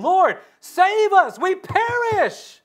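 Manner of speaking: high, loud panicked plea; desperate cry for rescue; disciples crying out. A narrator's reading. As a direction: cry out in terror on the boat.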